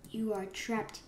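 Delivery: soft half-whispered gloat; hushed villain taunt. Pretend. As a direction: murmur it quietly to the caught prey. Villain line, first hushed take.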